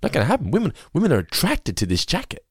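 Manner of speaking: a hint of stubborn Kramer; Kramer refusing. Kramer refusing to return the jacket, lightly voiced. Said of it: "And they're like".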